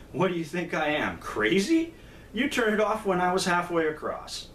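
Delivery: high-pitched, acted indignant disbelief; incredulous refusal. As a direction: play the punchline as an outraged madman. Second madman indignantly refuses in joke.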